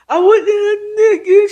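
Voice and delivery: very high, hammed-up crying babble; whimpering explanation. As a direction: wail through a garbled excuse, imitating. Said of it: "still trying to explain".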